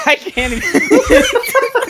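high, slow words squeezed out through laughter; can barely get it out for laughing; helpless laughter. Real, not acted. Laughing too hard to talk.

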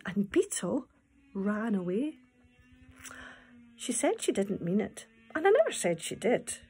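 lively storytelling read-aloud; poem reading. Acted. Teacher reading the beetle poem to children.

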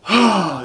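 loud, high exhaled sigh; exasperated sigh. Real, not acted. Gamer stuck on the puzzle, loud exasperated sigh. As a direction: heave out a big frustrated sigh.

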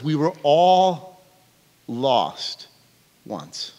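slow, fading to near silence; dramatic title. Real, not acted. Preacher unveils the message title, slow and fading.

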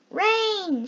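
high, happy cry of rain; gleeful call. A narrator's reading. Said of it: "said Snot".

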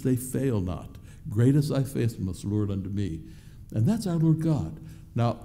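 ordinary talk, nothing marked

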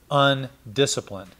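ordinary delivery

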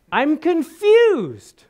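high, loud, very slow whine; John doubting in his cell; confused prisoner. Retold or imitated. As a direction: draw out the confusion.

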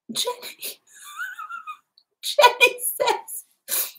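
high, squeaky laugh-choked voice; real giggling fit squeezing the words; helpless laughter. Real, not acted. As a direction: crack up while trying to read out a name. Cracks up at viewer's comment.

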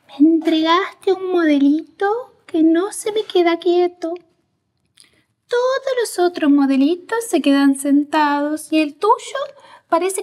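high-pitched, drawn-out mock teacher complaint; maestra quejándose. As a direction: imitate an exasperated teacher in a put-on high voice. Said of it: "y ella me dice". (imitación aguda de una maestra quejosa)

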